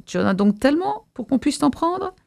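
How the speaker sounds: high-pitched taunting question; jeering yell. A narrator's reading. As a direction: throw the question up high, not fully shouted. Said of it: "hurlèrent-ils". (question railleuse, haut perchée)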